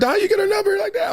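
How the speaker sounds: high, quick mock disbelief; mad and happy. Imitating a friend asking about her number, high and half-put-out. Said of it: "he was mad and happy for me".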